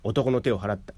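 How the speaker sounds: ordinary delivery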